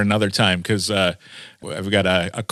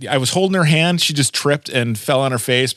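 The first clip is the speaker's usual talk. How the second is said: ordinary talk, nothing marked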